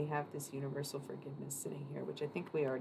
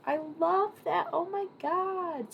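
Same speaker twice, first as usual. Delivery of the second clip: very high, loud gush; thrilled, high-pitched exclamation; delighted squeal; 13 semitones higher, 6 dB louder, slower than usual. Real, not acted. Delighted reaction to audience.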